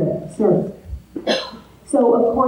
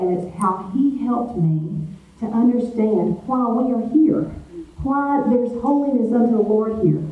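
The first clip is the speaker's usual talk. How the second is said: ordinary talk, nothing marked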